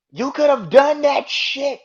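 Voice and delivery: high, loud exasperated outburst; real anger. Real, not acted. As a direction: throw the accusation out loudly. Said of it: "I'm still pissed".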